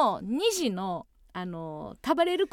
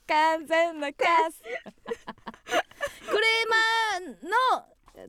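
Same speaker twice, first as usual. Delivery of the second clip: savoring the punchline; 5 semitones higher, much slower than usual. Retold or imitated. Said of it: "最後、カス".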